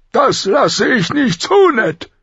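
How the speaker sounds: slow, high, loud refusal; outraged royal veto; angry king. A narrator's reading. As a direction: lay down the law forcefully. King forbids, raging.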